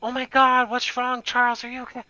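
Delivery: high, alarmed mother voice; a hint of a frightened mother; worried mom. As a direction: play the panicked mom rushing in. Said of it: "She goes like".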